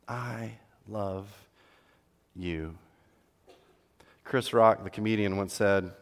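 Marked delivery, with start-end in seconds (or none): 0.1-2.8 soft tender. Shown as a demonstration. Modeling words of love.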